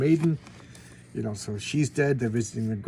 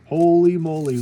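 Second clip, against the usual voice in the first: holy moly; 4 semitones higher, 10 dB louder than usual. Real, not acted. Reacting to the secret bunker.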